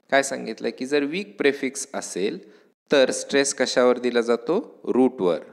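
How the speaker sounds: ordinary talk, nothing marked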